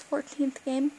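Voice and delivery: ordinary delivery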